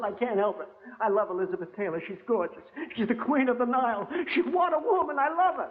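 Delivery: swooning over the star, voice climbing; lovestruck fan. Retold or imitated. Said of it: "The fella said".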